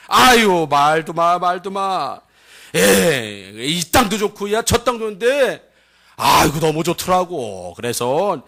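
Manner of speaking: animated Chungcheong yarn; Chungcheong dialect. Acted. Spy recounts the fearsome peoples, animated Chungcheong accent. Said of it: "충청도 버전으로".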